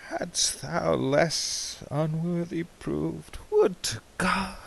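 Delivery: breathless whispered wish; hushed whisper. Acted. Whispered aside in the poem.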